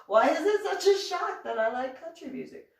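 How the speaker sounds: high, loud exasperated protest; indignant challenge. Retold or imitated. Defending country music to a doubter, indignant. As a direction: push back hard, pitch right up. Challenging his surprise at her taste.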